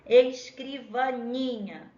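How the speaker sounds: "Escrivaninha" slowed, leaning on the long NI; calling the word. Shown as a demonstration. Teacher showing the stressed syllable, "Escrivaninha" called slowly with NI lengthened. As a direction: call out "Escrivaninha", stretching the "ni". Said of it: "a gente chama a palavra".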